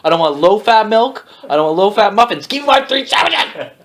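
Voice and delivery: high-pitched, hammed-up outrage; indignant customer rant. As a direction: play a customer ranting over a botched order. Improvised angry customer rant.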